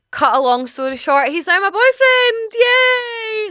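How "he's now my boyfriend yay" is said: very high, gleeful cheer; excited squeal. Real, not acted. Storyteller revealing he became her boyfriend, high excited squeal.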